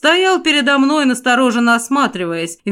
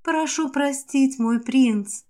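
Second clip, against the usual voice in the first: soft slow whisper; whispering apology; 3 semitones higher, 6 dB quieter, slower than usual. A narrator's reading. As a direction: whisper it with lowered eyes. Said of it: "прошептала она".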